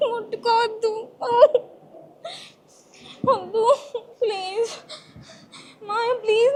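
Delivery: high, tearful begging; crying pleading. Acted. Girl crying and begging uncle to stop, high tearful pleading. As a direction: sob and plead for him to stop.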